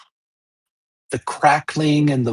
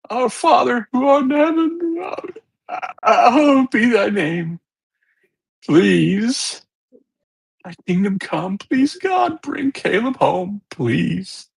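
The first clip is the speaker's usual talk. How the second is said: high, broken, tearful Lord's Prayer; sobbing prayer; 9 semitones higher, slower than usual. Acted. Mother on the tape murmuring the Lord's Prayer, sobbing. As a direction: plead through the sobs. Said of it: "you start to hear heavy sobbing".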